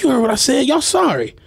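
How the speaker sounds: high, openly scornful repeat, no longer hidden; sassy retort. Retold or imitated. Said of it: "She like".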